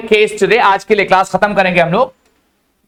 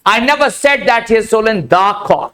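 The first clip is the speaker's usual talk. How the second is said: "the" said full as "daa" and emphasised; stress on THE; much slower than usual. Shown as a demonstration. Teacher singling out one bird, stress on "the". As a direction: lean on "the" to mean this particular one. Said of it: "ये वाला good मुर्गा".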